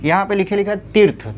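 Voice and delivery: ordinary talk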